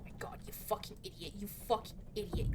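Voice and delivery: soft, exasperated under-the-breath scold; hushed curse. Real, not acted. Gamer cursing a teammate under the breath, quiet scold. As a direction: mutter it so nobody hears.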